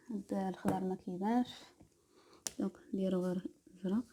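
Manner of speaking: ordinary talk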